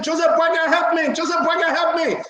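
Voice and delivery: a retold girl's plea, mildly acted; mild mock scream. Host voicing the girl calling the president for help. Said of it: "The little girl was screaming".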